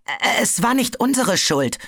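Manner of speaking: anxious stammered excuse; stammering. A narrator's reading. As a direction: stumble over the words, scared of blame. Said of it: "stammelte er".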